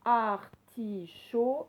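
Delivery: "Artichaut" loud and slow, as ar, ti, chaut; syllable by syllable. Shown as a demonstration. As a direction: break "Artichaut" into three deliberate syllables. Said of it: "on l'entendait dans la troisième syllabe". (« Artichaut » lentement, en détachant chaque syllabe)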